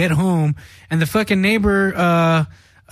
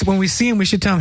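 normal talking voice

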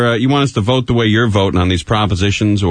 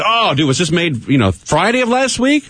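mocking coworker; 8 semitones higher than usual. Coworker mocks old coffee.

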